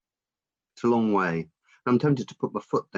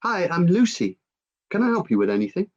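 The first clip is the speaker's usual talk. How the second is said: high, lively shop assistant greeting; Lucy; 10 semitones higher, 4 dB louder than usual. Acted. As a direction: offer help brightly, pitched up and quick. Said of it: "I remember her first words to me".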